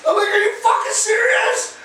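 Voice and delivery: re-enacted disbelief, very high; high-pitched mock outrage; incredulous. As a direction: react as if she can't mean it. Acting his disbelief at her request.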